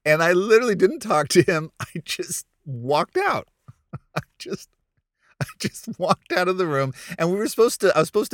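can hardly get it out for laughing; laughing while telling. Real, not acted. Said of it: "laughing while telling".

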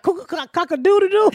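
high rooster crow, imitating the man; chicken crow. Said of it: "He sound like a chicken".

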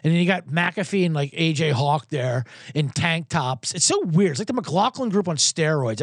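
normal talking voice

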